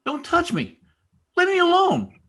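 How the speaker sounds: very high, rising to a shout; high, panicked child's cry; frightened boy's protest. Acted. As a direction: fend off a grown-up's hands. Frightened beaten boy resists servant.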